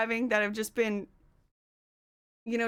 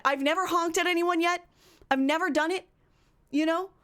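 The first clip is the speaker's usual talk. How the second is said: ordinary talk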